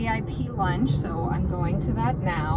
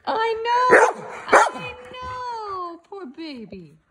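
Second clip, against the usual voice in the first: high pet talk; 12 semitones higher, slower than usual. Real, not acted. Owner comforting her dog in high baby talk.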